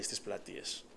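whispered, voiceless; a soft, voiceless whisper. Retold or imitated. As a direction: breathe it out without voice. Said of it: "η φωνή του Θεού ... ψιθυριστή".

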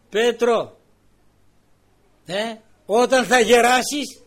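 loud, high, slow declaration; solemn loud proclamation. Acted. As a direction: declare it as scripture. Christ's words proclaimed.

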